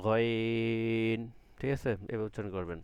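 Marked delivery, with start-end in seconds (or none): 0.1-1.2 গয়ন drawn long but not pressed in the throat; normal ghain. Shown as a demonstration. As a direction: say গয়ন plainly, long and easy. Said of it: "normally হবে". (স্বাভাবিকভাবে লম্বা গয়ন)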